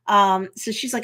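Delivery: usual speaking voice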